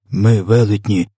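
ordinary delivery

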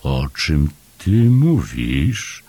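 slow, drawn-out puzzled question; baffled Paszczak. A narrator's reading. Paszczak not following Chomek's secret, slow baffled question. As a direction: stretch it out in bewilderment.

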